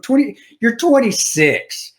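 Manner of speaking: high, drawn-out disbelief; exasperated and slow; incredulous. Real, not acted. Repeats disbelief at her age.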